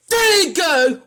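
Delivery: high, loud quoted catchphrase; angry mocking quote. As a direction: yell the hated catchphrase back. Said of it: "would not say".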